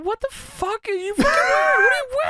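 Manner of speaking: high-pitched imitated outburst; mock outraged shout. Wrestler pointing at teammate's shirt, mock outraged shout. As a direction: yell it in mock disbelief, voice shooting up. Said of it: "he points at him and says".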